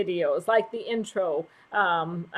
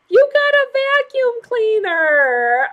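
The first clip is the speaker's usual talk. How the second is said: high, louder, slow mock-amazed; delighted tease; 10 semitones higher, 7 dB louder than usual. Real, not acted. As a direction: marvel at him teasingly. Teasing husband about vacuuming.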